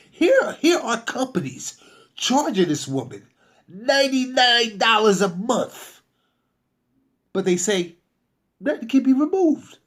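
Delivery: indignant emphasis. Real, not acted. Outraged at rival companies.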